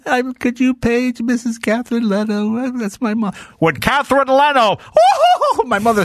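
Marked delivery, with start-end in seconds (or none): 0.1-3.3 put-on little kid. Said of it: "run up to the manager and go". 3.6-4.8 store PA page. Retold or imitated. Store manager paging over speaker. 4.8-5.6 high burst of laughter; real laugh. Real, not acted. Jay laughing at his own store story, high burst of laughter.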